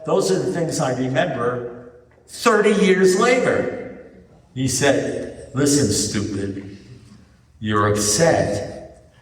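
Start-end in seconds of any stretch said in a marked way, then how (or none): 5.5-9.1 slow, measured put-down; deliberate verdict. Retold or imitated. Sponsor explaining to an upset man, deliberately. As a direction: spell it out slowly, holding back the answer.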